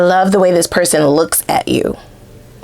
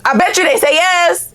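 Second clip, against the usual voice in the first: "I bet you they say yes" sung out much higher; high, playful; 10 semitones higher than usual. Shown as a demonstration. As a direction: tease it up high.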